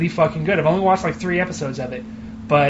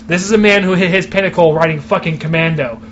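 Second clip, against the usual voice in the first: ordinary delivery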